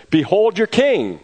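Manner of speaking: high, loud and slow presentation; proclaiming. Acted. Pilate presents Jesus as king to the crowd, slow high proclamation. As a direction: announce him slowly to the crowd.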